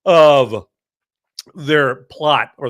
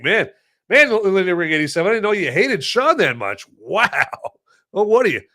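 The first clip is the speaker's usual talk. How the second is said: ordinary talk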